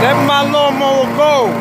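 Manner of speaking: high, loud command to a dog; yelling at dog. Real, not acted. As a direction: shout it to make the dog let go. Owner yelling at dog biting mower.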